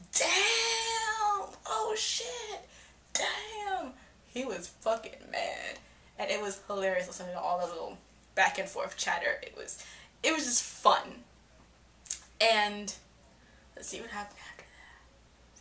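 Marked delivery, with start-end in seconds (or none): 0.1-3.9 much higher, shouted excitement with laughter; crowd shouting. Retold or imitated. Theater crowd reacting to Voldemort, shouting higher and laughing. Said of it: "everyone's like". 13.8-15.6 whispered aside. Real, not acted. Aside to self while recalling.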